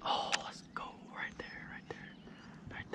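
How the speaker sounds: real excited whisper, very soft; barely audible, breathy whisper; hushed whisper. Real, not acted. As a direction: whisper it under your breath as you spot something. Spotting something in the water.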